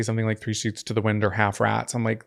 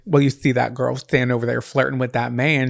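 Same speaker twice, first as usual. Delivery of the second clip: put-on country accent. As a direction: lay on a folksy country twang. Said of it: "You're doing a country accent".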